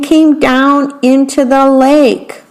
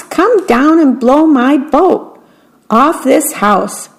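lightly acted storybook call; mild shout; 4 semitones higher than usual. A narrator's reading. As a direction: call out without really raising volume. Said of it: "shouted the mouse".

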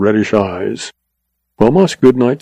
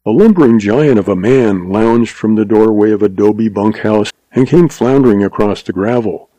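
ordinary delivery

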